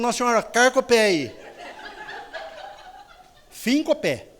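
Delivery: chuckling through a playful plea; laughing mock prayer. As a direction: say it while laughing at the joke.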